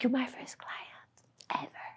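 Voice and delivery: hushed, nervous admission; whispered confession. Retold or imitated. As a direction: confess it under your breath.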